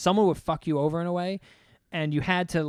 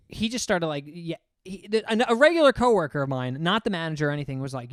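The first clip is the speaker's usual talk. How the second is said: ordinary talk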